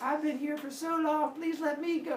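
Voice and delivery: put-on pleading girl. Girl stuck in the waiting line begging Charon, high plea. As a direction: beg the boatman like a tired little girl. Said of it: "Allie would be like".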